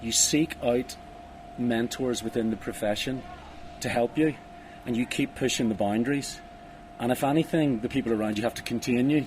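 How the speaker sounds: normal talking voice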